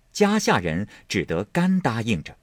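plain reading voice

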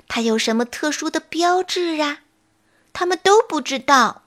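ordinary delivery

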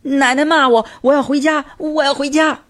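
high wailing tantrum; wailing child. A narrator's reading. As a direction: howl it like a spoiled boy rolling on the floor. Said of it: "一边哭喊道".

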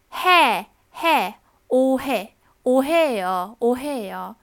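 "嘿" as Korean hae, loud and slow; "哦嘿哦" said o-hae-yo, stretched; correct hae sound. Shown as a demonstration. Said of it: "而是". ("哦嘿哦"慢慢念成 오해요)

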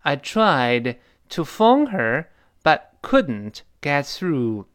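slow, drawn-out model sentence again; slow repeat. Shown as a demonstration. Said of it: "你比方说".

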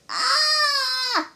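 long, very high "aah" of pain; loud, drawn-out bellyache wail; pained groan. A narrator's reading. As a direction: groan out as the stomach cramps. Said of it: "and groaned".

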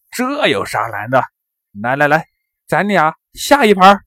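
self-satisfied, rising to a loud dare; smug boasting. A narrator's reading. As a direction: brag that it's easy, then loudly demand a game. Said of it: "王贵得意地说".

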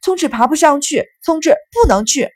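high, loud dogged protest; high-pitched obstinate outburst; stubborn insistence. A narrator's reading. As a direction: keep insisting over everyone else. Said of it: "只顾着一个劲儿地说".

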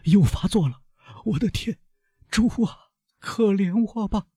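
high-pitched frightened plea; terrified cry. A narrator's reading. Servant feeling the fit return, frightened high pleading. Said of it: "巴鲁瓦大声说".

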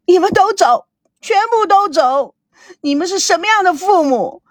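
high, anguished pleading yell; wailing outburst. A narrator's reading. Daughter blaming her parents, wailing and yelling. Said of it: "大哭大叫起来".

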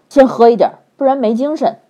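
gruff demand. A narrator's reading. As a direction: insist loudly on the wine. Li Jing demands wine.

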